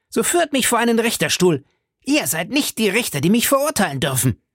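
very high, frantic demand; screeching. A narrator's reading. Milady demands a proper judge, screeching high and frantic. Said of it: "kreischte Milady".

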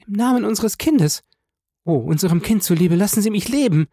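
high, imploring mother's voice; acted frightened appeal; desperate plea. A narrator's reading. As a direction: beg frantically, pitched well up. Wife begging for her life.

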